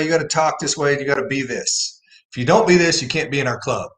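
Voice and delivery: usual speaking voice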